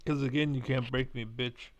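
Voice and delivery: ordinary delivery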